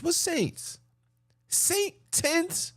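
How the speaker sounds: high mocking disbelief; what, saints, in high disbelief; incredulous. Real, not acted. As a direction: squeak it out in scorn. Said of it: "mocking the name Saints".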